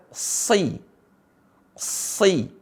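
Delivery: "sei" with long hissed s, no rounded o; careful sustained sibilant on "sei"; correct heavy sad. Shown as a demonstration. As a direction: keep the vowel of "sei" unrounded after a thick s. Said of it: "Tapi yang benar adalah".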